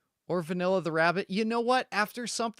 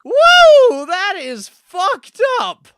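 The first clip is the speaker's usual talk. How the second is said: shocked yell; 13 semitones higher, 17 dB louder, much slower than usual. Real, not acted. Recoiling from creature scene.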